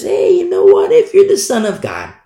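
high, loud tempter's challenge; taunting Satan. Acted. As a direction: play the devil goading with a high-pitched dare. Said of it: "he goes to Jesus Christ and says".

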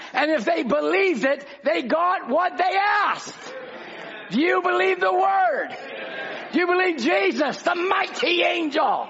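full-force preaching. Acted. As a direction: preach it out with a ringing shout.

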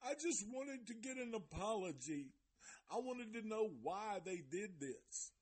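high-pitched tearful imitation; mock crying. Reviewer imitating Natalia crying over the apology, mock crying. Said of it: "she's crying".

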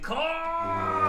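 high, drawn-out anguished cry of Khan; Spock yelling Khan. Retold or imitated. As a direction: wail the name out, stretched long. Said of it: "having Spock say".

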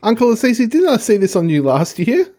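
snarky relative. Pretend. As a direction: tease the uncle about a regifted present. Mocking a relative regifting a present.

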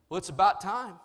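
emphatic mock pronouncement; imitated pastor. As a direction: punch it like an unimpressed pastor. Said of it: "he looked at me, and he said".

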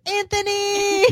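"Anthony!" called high; imitating their own fan call; high mock call. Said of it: "we're always like".

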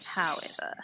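very soft, in-character aside; barely voiced "however"; hushed restart. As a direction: whisper "however" under the breath. Same word restarted much softer.

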